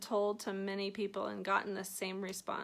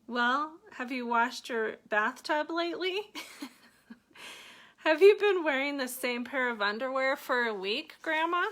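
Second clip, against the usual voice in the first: higher, louder playful jabs; mock teasing; 4 semitones higher, 4 dB louder than usual. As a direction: tease grandma with a grin. Teasing grandma in retold story.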